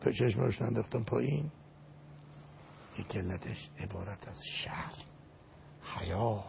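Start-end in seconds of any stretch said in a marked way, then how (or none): 3.0-6.4 softer, voiceless whisper; whispered word of shame; ashamed whisper. Acted. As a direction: hush it as if ashamed. Speaking of shame.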